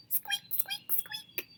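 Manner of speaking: tiny, very high squeaks; hamster squeak. Acted. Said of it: "it came out".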